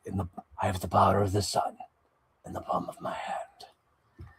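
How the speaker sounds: breathy whisper of the line; whispered quote. Retold or imitated. Quoting the figure's line to the viewer, whispered. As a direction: whisper it like a secret. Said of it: "it has, like".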